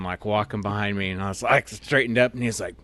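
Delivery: ordinary talk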